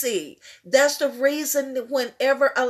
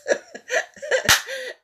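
joyful laugh; 11 semitones higher than usual. Real, not acted. Laugh after the line.

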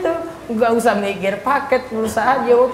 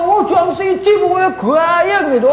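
gushing congregant; 7 semitones higher, 3 dB louder than usual. Acted. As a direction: sell how lively it was, dreamily. Acted congregant answering again.

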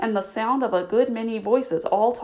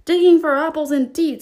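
high, loud scornful scoff; angry; 7 semitones higher, 8 dB louder than usual. Acted. Rabbit scolding Pat, angry and loud. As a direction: spit it back in a temper. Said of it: "said the rabbit angrily".

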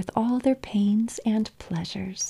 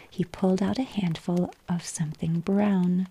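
ordinary delivery, nothing marked

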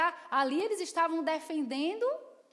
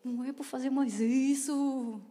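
dismayed self-reproach; 3 dB louder than usual. Retold or imitated.

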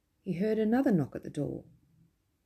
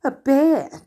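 disgust; 9 semitones higher, 11 dB louder than usual. Acted. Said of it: "declared Mister Murgatroyd in disgust".

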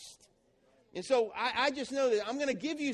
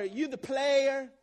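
mocking label; 4 semitones higher, 5 dB louder, much slower than usual.

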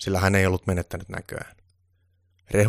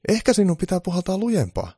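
very high, loud elf voice; squeaky-high little helper; elf suggesting; 12 semitones higher, 6 dB louder than usual. A narrator's reading. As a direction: pipe up with a helpful suggestion. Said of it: "Eero tonttu ehdotti".

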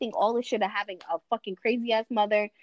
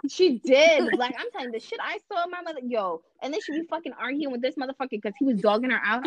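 normal talking voice

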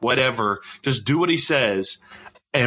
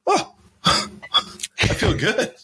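slow, laughing "oh, I feel good"; laughing gloat; much slower than usual. Retold or imitated. Soldier gloating to himself over a sweating squadmate, laughing. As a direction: savour it through a giggle.

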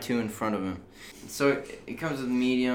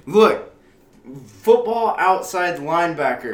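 raised, insistent voice; louder, pushing back hard; heated objection; 6 semitones higher, 11 dB louder than usual. Real, not acted. Arguing over edge rusher.

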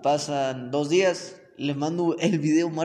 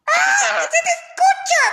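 very high, loud, laughing shriek; funniest voice ever; 9 dB louder, slower than usual. Retold or imitated. Retelling the boyfriend's absurd phone voice, high and loud. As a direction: shriek it in a ridiculous voice. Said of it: "con la voz más cagada del mundo".

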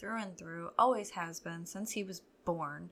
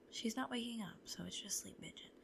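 whisper; 9 dB quieter than usual. Real, not acted. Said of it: "whispering near sleeping child".

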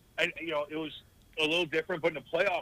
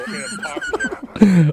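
words dissolving into a high laugh; 14 dB louder than usual. Real, not acted. Telling friends about being a cocky teen, bursting out laughing. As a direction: break into laughter mid-sentence. Cracking up mid-sentence.

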